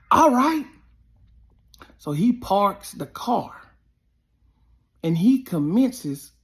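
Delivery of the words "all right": much higher, louder accepting; loud cocky acceptance; sharp high taunting all right. Retold or imitated. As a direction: call back a dare, cocky. Heckler accepting challenge.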